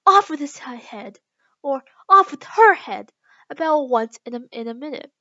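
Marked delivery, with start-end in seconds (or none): 0.1-1.2 loud burst of royal fury; loud, angry command; furious shouting. A narrator's reading. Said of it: "in a furious passion and went stamping about and shouting". 2.1-3.1 high, loud, slow royal order; drawn-out angry command; furious shouting. A narrator's reading. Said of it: "in a furious passion and went stamping about and shouting".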